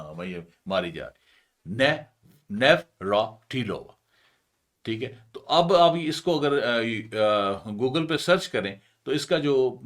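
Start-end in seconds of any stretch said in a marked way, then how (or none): 1.7-3.9 نیوراتیلووا slowly as nev-ra-ti-lova; false start, then pause between the chunks of نیوراتیلووا; broken into chunks. Shown as a demonstration. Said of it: "میں نے اس کی chunk بنایا". (نیوراتیلووا کو ٹھہر ٹھہر کر، ٹکڑوں میں)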